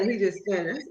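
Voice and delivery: normal talking voice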